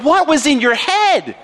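God questioning Abram, disappointed; pleading rebuke. Pretend. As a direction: let each why land like disappointment. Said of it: "God's saying to Abram".

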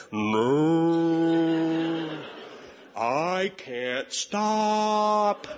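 drawn out, stretched very slow; slow motion. Retold or imitated. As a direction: say it as if time slowed down.

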